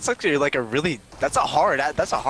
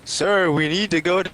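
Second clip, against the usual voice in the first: quoted video voice; 7 semitones higher, 9 dB louder than usual. Retold or imitated. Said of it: "It was like".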